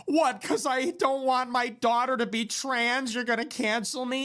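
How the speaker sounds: high, mocking complaint; put-on aggrieved crybaby voice; whiny loser. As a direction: mimic a pitiful whiner protesting.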